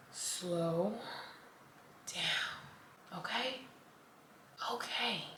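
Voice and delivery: slow breathy whisper, very soft; whispered mantra. Shown as a demonstration. Leading viewers in a calming mantra, whispered slowly. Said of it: "Say it with me".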